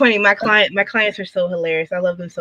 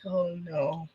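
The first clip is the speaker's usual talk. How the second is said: much lower and softer oh no; hushed dismay; 5 semitones lower, 15 dB quieter than usual. Retold or imitated. Client realizing designer disapproves, in a hushed low groan. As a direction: let it sink like doom.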